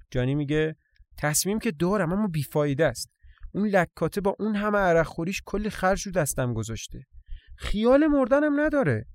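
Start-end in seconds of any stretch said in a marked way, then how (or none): 1.2-9.1 gripe, climbing to a high exasperated finish; exasperated grumbling gossip. Acted. Johnny grumbles that his drinking mother won't die, exasperated. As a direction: voice rising in comic exasperation.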